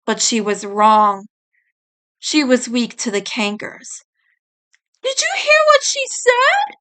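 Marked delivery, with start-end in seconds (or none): 5.0-6.8 put-on high, shocked girl's gasp. May gasping in shock at what she heard. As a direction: gasp it out in disbelief. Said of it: "May gasped".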